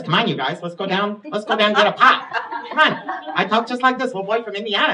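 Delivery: put-on high, loud Indiana boy's accent; Midwestern accent. Shown as a demonstration. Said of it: "and we talked like this".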